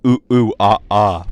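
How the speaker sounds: loud monkey hoots into "monkey mode"; monkey chatter. Pretend. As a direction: hoot like a monkey, then settle. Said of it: "monkey mode".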